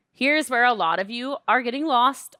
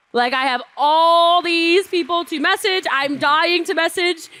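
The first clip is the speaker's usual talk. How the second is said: louder, higher, gushing emphasis; acted eagerness; 3 semitones higher, 4 dB louder than usual. Viewer itching to message everyone, loud and eager. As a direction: burst with it, stretching the key words.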